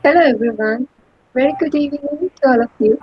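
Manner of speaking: ordinary talk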